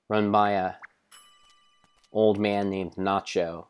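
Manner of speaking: ordinary delivery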